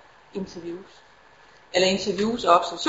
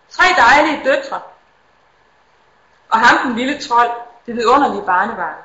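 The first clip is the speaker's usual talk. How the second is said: poem reading. Acted. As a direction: read it gently, letting each line land. Reads a poem aloud.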